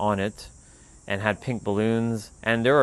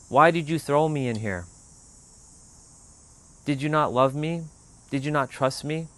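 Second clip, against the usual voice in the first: a lightly voiced little boy, a touch higher and pleading; hurt little child; 5 semitones higher than usual. Little self crying to narrator.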